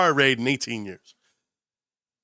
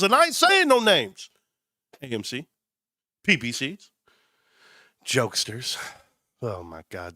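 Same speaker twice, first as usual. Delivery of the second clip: usual speaking voice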